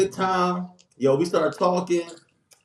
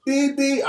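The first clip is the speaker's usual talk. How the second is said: car horn; 8 semitones higher than usual. Retold or imitated. Imitating car horns.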